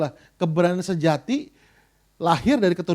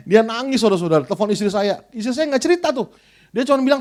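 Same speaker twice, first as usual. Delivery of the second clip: ordinary talk, nothing marked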